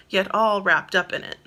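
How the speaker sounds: ordinary delivery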